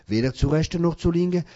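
usual speaking voice

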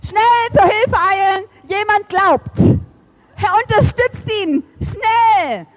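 high, loud, urgent rescue call; rally the angels like a fire crew; heaven's alarm, high and loud. Retold or imitated. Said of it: "wie bei der Feuerwehr".

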